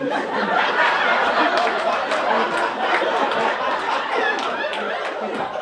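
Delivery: big high burst of laughter, half breath; loud laugh. Real, not acted. Storyteller cracking up while quoting the cop, laughing loudly. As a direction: break up laughing mid-sentence.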